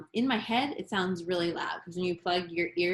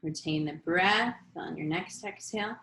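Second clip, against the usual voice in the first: guided meditation. Acted. Leads breathing practice.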